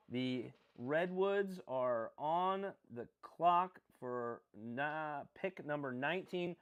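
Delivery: slow drawn-out draft announcement; announcer call. Acted. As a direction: call the pick like a draft host.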